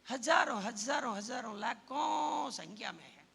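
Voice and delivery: हजारों hurled out loud, then fading a little into लाखों; loud repeated हजारों, driven home; 1 preaching shout. Real, not acted. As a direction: build a shouted count from the pulpit.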